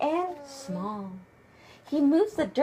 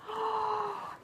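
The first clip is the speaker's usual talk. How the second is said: gasp; 8 semitones higher, 8 dB quieter than usual. Pretend. Surprised at picture.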